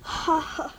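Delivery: soft, mocking ha-ha, dropping away; hushed sarcasm; sarcastic fake laugh. Real, not acted. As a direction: feign amusement, then let it fade. Sarcastic reply to friend's joke.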